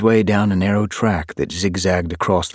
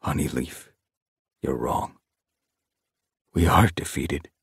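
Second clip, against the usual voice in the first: aching with sadness. A narrator's reading. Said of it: "his voice aching with sadness".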